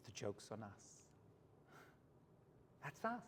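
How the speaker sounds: normal talking voice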